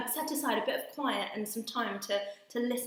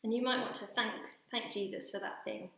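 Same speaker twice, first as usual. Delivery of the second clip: guided prayer; 3 semitones lower, 5 dB quieter than usual. Acted. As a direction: gently lead a quiet prayer. Leads children in guided prayer.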